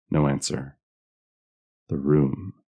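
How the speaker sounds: ordinary delivery, nothing marked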